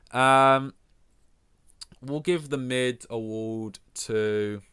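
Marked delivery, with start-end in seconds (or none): 2.0-4.6 drawn-out award announcement. Pretend.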